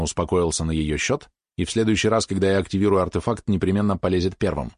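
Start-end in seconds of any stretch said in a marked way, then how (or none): none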